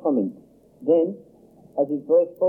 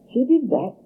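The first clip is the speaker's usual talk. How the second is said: put-on shrill, much higher, disbelieving child; shocked amazement; 12 semitones higher, 4 dB louder than usual. A narrator's reading. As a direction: gasp out the question in shock. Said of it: "tone of shocked amazement".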